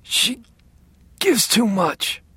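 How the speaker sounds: slow, high, strained gasp; pained gasp. A narrator's reading. Father warns his son about the nurse, gasping in pain. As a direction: gasp it out haltingly through pain. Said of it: "He gasped now".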